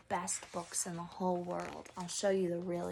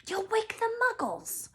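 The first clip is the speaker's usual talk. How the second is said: higher, stern hushed warning; McGonagall scolding; 14 semitones higher, 4 dB louder than usual. Acted. McGonagall warning Hagrid, stern and higher. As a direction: warn him sharply. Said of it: "hissed Professor McGonagall".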